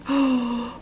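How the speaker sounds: soft sudden gasp; gasp of realisation. Real, not acted. Reader suddenly working out the story, soft gasp. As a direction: gasp quietly as it dawns.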